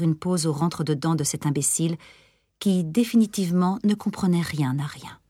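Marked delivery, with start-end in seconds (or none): none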